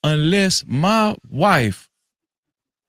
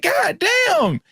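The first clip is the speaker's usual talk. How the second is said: exasperated god damn; 11 semitones higher than usual. Retold or imitated. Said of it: "I be like".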